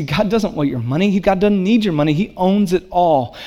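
normal talking voice

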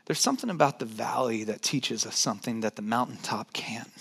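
quiet whispered point; hushed truth shared close. Real, not acted.

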